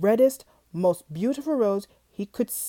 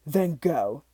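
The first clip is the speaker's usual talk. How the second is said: low dismissive beast; 6 semitones lower than usual. Acted. Beast turns away.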